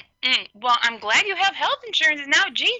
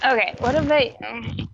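normal talking voice

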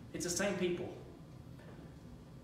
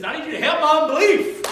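pleading father; 11 semitones higher, 16 dB louder than usual. Retold or imitated. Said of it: "He says".

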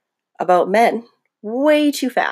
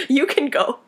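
normal talking voice

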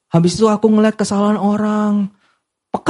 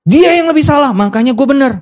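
blame-shifting person; 8 semitones higher than usual. Retold or imitated. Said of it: "Dia lebih suka gini".

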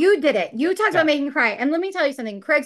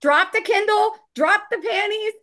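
excited teasing; 9 semitones higher than usual. Real, not acted. Teasing Craig's writing effect.

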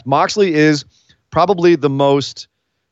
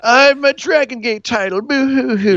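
high whiny mock sobbing; hammed-up boo hoo whine; fake crying; 10 semitones higher than usual. Mocking Pac's complaint, boo hoo.

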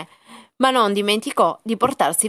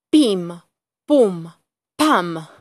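gunshot sounds; 5 dB louder, much slower than usual. A narrator's reading. Gunshots in the story.

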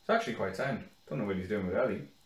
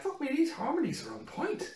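laughing; 13 semitones higher, 5 dB quieter than usual. Retold or imitated. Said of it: "I'm like".

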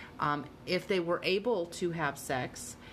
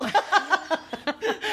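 big burst of laughter; cracking up loudly; 12 semitones higher, 8 dB louder than usual. Real, not acted. Embarrassed laughter after awkward joke.

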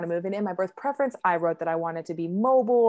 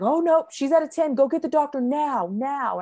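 higher, louder imitated alarm, easing at the end; urgent order; 7 semitones higher, 5 dB louder than usual. Nurse finding her fully dilated, urgent order. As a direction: send someone running for the doctor.

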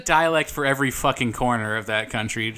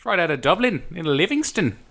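raised-pitch mock Dublin brogue; Irish accent; 4 semitones higher than usual. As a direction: show off a put-on Irish accent. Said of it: "so that's your accent".

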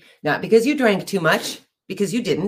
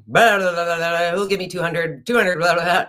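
auctioneer. Retold or imitated. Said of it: "the auctioneer says".

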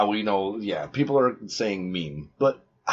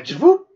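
mock whoop; 11 semitones higher, 9 dB louder than usual. Imitating bike crash noise.

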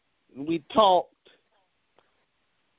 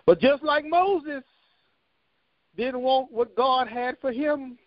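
rising preacher's call, higher; preaching; 8 semitones higher than usual. Real, not acted. Pastor urging congregation, preaching higher. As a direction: lift the voice into a preaching climb.

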